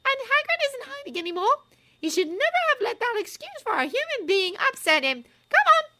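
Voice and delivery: put-on high, loud, furious girl's voice; raging. A narrator's reading. Hermione raging about Hagrid to Ron and Harry, high and loud. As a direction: lash out in fury. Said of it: "Hermione in a rage".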